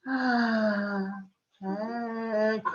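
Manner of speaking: long, held-out dopey syllables; dazed drooling gawkers. Retold or imitated. Male gods stare at her drooling, dazed drone. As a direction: stretch each word in a stupefied drone. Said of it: "they're just going".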